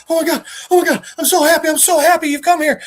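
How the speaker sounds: high, gushing mock elation; overjoyed greeting. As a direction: imitate someone overwhelmed with joy, speeding up. Rapturous radio caller greets plane.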